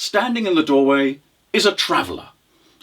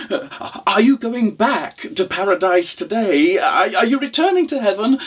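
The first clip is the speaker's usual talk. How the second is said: high, eager questioning voice; hopeful widow; 8 semitones higher than usual. Acted. Widow asking traveller about returning to heaven, hopeful.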